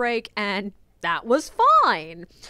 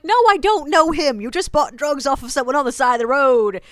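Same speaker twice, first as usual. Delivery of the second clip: exasperated retort; 2 semitones higher, 5 dB louder than usual. Retold or imitated. Guide retelling her reply to drug-buying guests, loud and exasperated. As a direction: blurt it out in loud disbelief.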